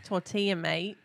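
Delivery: soft, offhand Australian accent. Shown as a demonstration. Friend asked to say it Aussie-style, soft Australian accent. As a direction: say it quietly like an Aussie. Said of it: "say it with your Australian accent".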